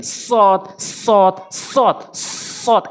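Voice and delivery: সৎ repeated with a long, heavy emphatic s; forceful sad drill. Shown as a demonstration. Teacher drills learners, forceful separate সৎ. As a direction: hold the hissing s of each সৎ firmly. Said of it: "এভাবে উচ্চারণ করবেন".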